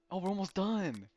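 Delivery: surprised. Real, not acted. Surprised at the progress.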